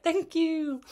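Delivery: high, sweet little thank you; exhausted runner thanking the marshal, high and sweet; grateful thanks. Retold or imitated. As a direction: thank them, voice lifted high.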